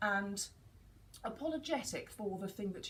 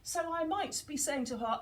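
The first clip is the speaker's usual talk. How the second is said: ordinary talk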